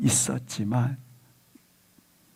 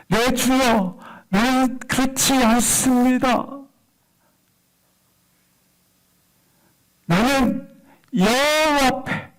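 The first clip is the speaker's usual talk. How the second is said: fervent preaching; 8 semitones higher, 3 dB louder than usual. Acted. Preacher declaiming Hannah's answer to the congregation, fervent preaching. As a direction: declaim the verse with rising fervour, pitch held high. Preacher re-reads scripture with fervour.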